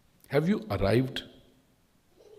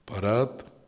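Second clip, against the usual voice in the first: ordinary delivery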